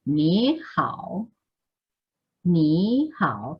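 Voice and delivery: "Ni" rising, then "hao" dipping; second plus third tone. Shown as a demonstration. Teacher models the correct greeting, rising "Ni". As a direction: raise "Ni" like a question, dip "hao". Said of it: "Este lo convierto en segundo".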